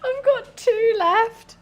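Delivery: high, slow mock sob; fake crying. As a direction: wail it like a toddler. Mock lament over last nails.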